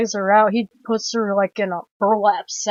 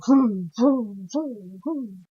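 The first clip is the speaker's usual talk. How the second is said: vocal chainsaw vrooms, fading softer; imitated chainsaw revving; 5 dB quieter, much slower than usual. Film fan copying Leatherface's chainsaw, mouth-made revving. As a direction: mimic repeated engine revs, dying away. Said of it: "Leatherface with the chainsaw go".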